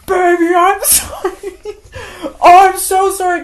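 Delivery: very high, loud hammed-up wail; fake crying. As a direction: bawl the apology with exaggerated fake sobs. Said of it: "there are no tears coming out".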